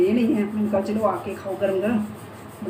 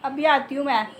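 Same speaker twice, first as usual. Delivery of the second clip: ordinary delivery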